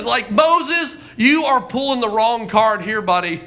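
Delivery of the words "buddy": usual speaking voice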